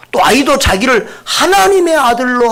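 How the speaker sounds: ordinary talk, nothing marked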